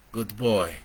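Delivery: usual speaking voice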